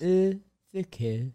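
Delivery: tongue pinned down, trying French "au secours"; clumsy learner's French accent, mouth half-closed; French accent attempt. Shown as a demonstration. Cohost trying the host's tongue tip, French accent attempt. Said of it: "tongue is pinned to the bottom".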